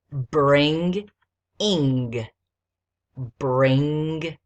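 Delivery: "bring-ing" in two loud, separate parts; syllables separated. Shown as a demonstration. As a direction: pause between "bring" and "ing". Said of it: "if the base word is bring".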